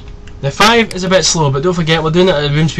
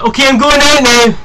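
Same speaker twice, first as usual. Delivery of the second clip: high, loud mimic of someone leaving; family announcing; 10 semitones higher, 5 dB louder than usual. Mocking family who announce going out, high and loud. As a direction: parrot the household announcing they're off, pitched up. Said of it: "Twenty minutes in peace and quiet".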